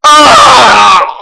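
long scream of alarm; loud scream. Real, not acted. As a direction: cry out as disaster hits. Hurt or killed in game.

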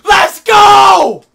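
high, loud hype yell; hype shout. Real, not acted. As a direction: cheer like you just won. Hype celebration on stream.

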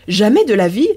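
loud, indignant outcry; the concierge shouts his denial; indignant protest. Acted. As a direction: insist hotly he's wrong. Concierge protests the bed size.

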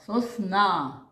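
"Сосна" with на long and strong; stretched, emphatic НА ending "Сосна"; stress on last syllable. Shown as a demonstration. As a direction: lean hard on the final на of "Сосна". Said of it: "а який наголошений склад?".

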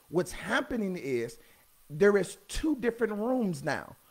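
low, hushed preacher's voice; confiding preaching. Real, not acted. Preacher sharing a mystery with the congregation, hushed and confiding. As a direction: drop right down as if letting them in on a secret.

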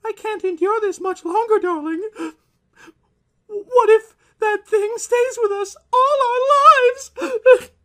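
terrified wife. Acted. Wife to her husband, a high panicked cry. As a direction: plead in rising panic.

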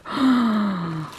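long, awestruck gasp; delighted gasp. Real, not acted. Unwrapping the bag she lusted after, delighted gasp. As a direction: gasp at something you have longed for.

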